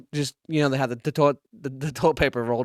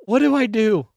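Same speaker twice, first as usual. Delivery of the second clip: mock panic; 7 semitones higher, 4 dB louder than usual. His panicked thought retold.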